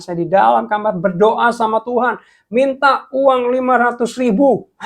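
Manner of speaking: high, loud emotional climax; impassioned retold plea. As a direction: build to an emphatic cry, slowing at the end. Retold tearful testimony of desperate prayer.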